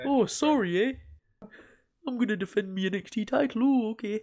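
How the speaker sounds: Canadian accent, done as a gag impression of the wrestler; mock Canadian accent. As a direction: play the Canadian wrestler as a cartoon. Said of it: "'cause he's Canadian".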